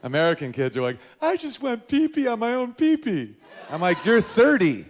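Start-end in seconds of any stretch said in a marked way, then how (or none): 1.2-3.3 put-on high, slow little kid voice; slow, high childish boast; proud little kid. 4.0-4.8 mock retort. Reply to kid.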